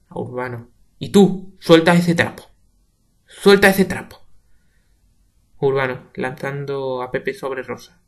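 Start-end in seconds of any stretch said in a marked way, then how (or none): 1.0-4.2 higher, much louder order; shouted command; angry command. Acted. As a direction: bark at him. Ordering Urbano.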